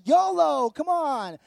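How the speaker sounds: high, loud mock-heroic cheer; retold gung-ho plea. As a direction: yell it to win her over, playfully. Retold yolo plea to his wife.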